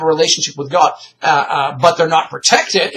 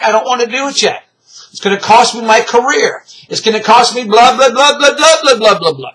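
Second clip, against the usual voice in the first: higher, rising blah-blah excuses; mock arguing back, pitched up; whiny mock protest; 6 semitones higher, 4 dB louder than usual. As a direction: imitate a sinner making excuses, louder as it goes. Voices sinners arguing back at God.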